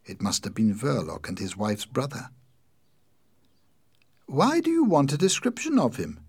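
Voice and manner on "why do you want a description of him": high, raised anxious questioning; nervous. A narrator's reading. Sister questions inspector about her brother, nervous. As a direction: ask it nervously, pitched well up. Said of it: "Winnie asked nervously".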